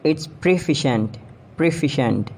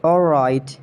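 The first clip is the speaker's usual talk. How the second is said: ordinary talk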